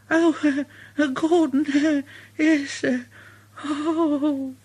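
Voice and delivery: high, hesitant little engine voice; stammer, dreading the big engine; Thomas flustered. Acted. Thomas dreads meeting Gordon.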